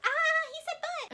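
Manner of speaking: put-on very high, loud, slow child's squeal; little kids squealing. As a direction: squeal like a giggling kid in a cinema. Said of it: "all the little kids in the theater will".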